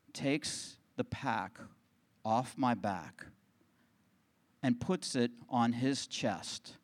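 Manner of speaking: ordinary talk, nothing marked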